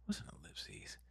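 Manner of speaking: real quiet background whisper; imitating Matt. Matt in the background asking a question, real quiet. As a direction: murmur it barely audibly, as if off mic. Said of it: "Matt in the background real quiet".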